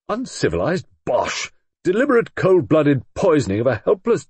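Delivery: loud, high, furious rebuttal; indignant rage. A narrator's reading. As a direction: spit out his outrage at the murder. Roger rebuts Clemency angrily.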